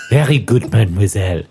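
mock French waiter, mademoiselle drawn down low; thick French accent. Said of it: "This is French".

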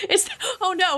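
laughing. Real, not acted. Laughing reaction to joke.

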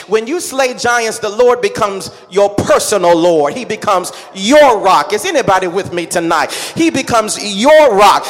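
ordinary talk

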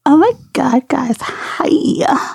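high, whispered fake Californian hi; mock valley girl greeting, way up high; put-on California girl, airy and high; California girl accent. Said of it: "Could you imagine if I spoke like that?".

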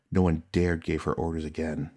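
plain reading voice

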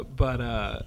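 ordinary delivery

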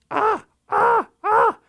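pushing with all his might; strained high heaving ahs; straining grunt. Acted. Raven pushing heavy salmon.

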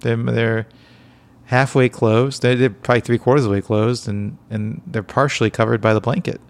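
usual speaking voice

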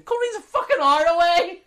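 high, loud comic outrage; exasperated outburst. Retold or imitated. Customer told the only stock is in Coleraine, exasperated. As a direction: yell in disbelief at the suggestion. Outraged at clerk's distant shop suggestion.

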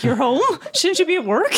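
high-pitched imitation of a startled wife; hammed-up bewildered questioning; mock surprise. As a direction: play caught off guard, pitch climbing. Acts wife surprised by his return.

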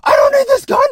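very high, loud hammed-up screaming; imitating the Commando bad guy; Commando villain yell. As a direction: scream it like a deranged movie villain. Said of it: "And the guy goes".

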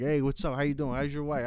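lightly hammed Italian restaurateur; gangster Italian owner. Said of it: "that greasy Dago ... gangster Italian guy".

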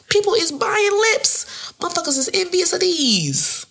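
high-pitched, hammed-up bragging; boastful exclaiming. Bragging to listeners about her big lips, boastful exclaiming. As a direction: proclaim it way up high, showing off.

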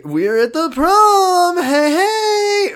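high, drawn-out giddy whoop; mock party cheer. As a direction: whoop it up like a cheesy sitcom teen. Mimics sitcom half of episode.

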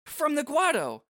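high-pitched excited gossip; excited Shalinda. Acted. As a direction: gush the gossip in a high bright voice. Shalinda answering.

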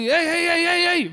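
a guard yelling for someone to stop; retold guard's shout. Embassy guard yelling at a drunk man in the parking lot, loud repeated calls. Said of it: "ומישהו צועק לי".

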